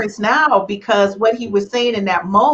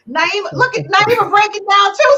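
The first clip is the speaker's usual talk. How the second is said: excited and emotional; 12 semitones higher, faster than usual. Real, not acted. Everyone crying on the show.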